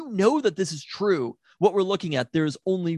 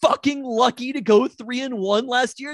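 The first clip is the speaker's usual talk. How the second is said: exasperated shout; 8 semitones higher, 6 dB louder than usual. Real, not acted. Heated podcast argument over football loss.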